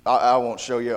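ordinary talk, nothing marked

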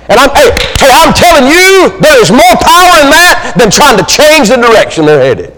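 high-pitched, fervent proclamation; full-force preaching. Real, not acted. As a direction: drive it home to the congregation at peak intensity.

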